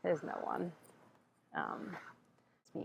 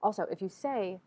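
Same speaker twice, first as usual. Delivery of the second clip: usual speaking voice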